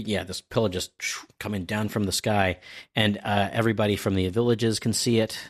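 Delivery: usual speaking voice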